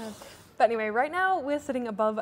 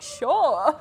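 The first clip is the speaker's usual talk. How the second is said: laughing sure; 3 semitones higher, 8 dB louder than usual. Retold or imitated. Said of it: "And I was like".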